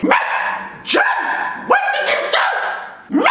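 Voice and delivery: very high, breathless squeak; squeaky mom. Retold or imitated. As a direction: squeal it in mock shock. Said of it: "my mom is like".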